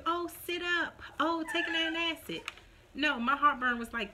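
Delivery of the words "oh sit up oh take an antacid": high, put-on helpful advice; mock advice. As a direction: parrot useless tips in a raised voice. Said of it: "They tell you".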